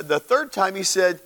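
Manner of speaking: normal talking voice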